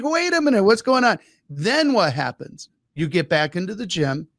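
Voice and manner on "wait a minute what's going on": higher, puzzled outcry; mock confusion. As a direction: sound baffled by the pain. Voicing confused gym newbie.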